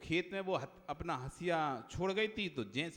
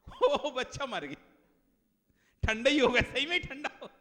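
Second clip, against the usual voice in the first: laughing; 7 semitones higher, 7 dB louder than usual. Real, not acted. Teller laughing at own joke.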